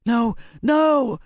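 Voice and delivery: high, loud, panicked no; struggling cry. A narrator's reading. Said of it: "as she struggled".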